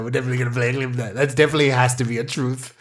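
ordinary talk

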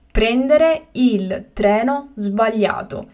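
slow and carefully spaced; slow, clear repetition. Shown as a demonstration. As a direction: say it again slowly for learners. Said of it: "teacher repeats the word twice".

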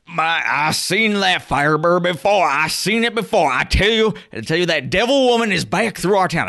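high, fast hillbilly rant; angry country bumpkin. Acted. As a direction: rant like a furious backwoods father. GM voices fat carriage driver.

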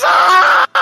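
sudden high scream; screaming cry. Retold or imitated. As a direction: let out a shrill cry. Character screams in scene.

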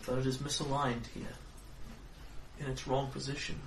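soft, solemn card reading; fortune teller. Acted.

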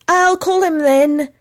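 high, ringing eager shout; like a colt's neigh; excited and resonant. Acted. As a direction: ring it out as he dashes off. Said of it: "as excited and resonant as a neigh".

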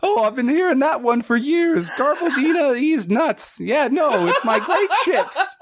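high squeaky voice, laughing proudly; proud otter shipwright. Acted. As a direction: brag through a chuckle in a squeak.

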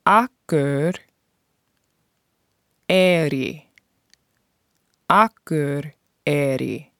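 slow word-by-word pronunciation demo of Akur, Eri; slowly. Shown as a demonstration. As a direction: break Akur and Eri apart slowly, pausing between. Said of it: "I'll say that slowly".

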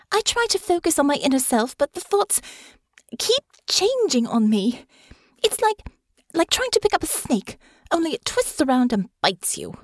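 put-on high young voice, straining with frustration; frustrated young heroine complaining; Honor, frustrated. A narrator's reading.

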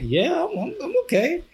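very high, amused reassurance; retold stifled laughter. Host answering his tearful former manager, stifling laughter. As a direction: answer as if barely holding back a laugh. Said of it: "and I started laughing".

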